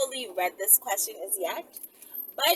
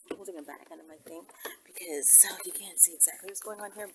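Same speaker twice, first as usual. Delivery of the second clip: whisper; 6 semitones lower, 12 dB quieter, faster than usual. Real, not acted. Hiding something under her bag.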